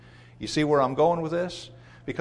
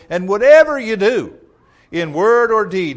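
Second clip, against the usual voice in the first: preaching emphasis; 7 semitones higher, 10 dB louder than usual. Acted. Preacher reading scripture with force.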